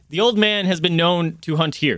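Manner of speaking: a hint of a commanding captain; gruff captain figure. Captain laying out the hunt to the party, lightly commanding. Game master plays the captain.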